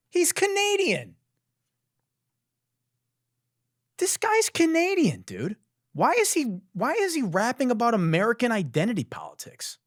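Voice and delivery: very high-pitched disbelief; incredulous outrage. Real, not acted. Host ranting about Canadian rapper's politics, incredulous. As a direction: protest it as utterly absurd.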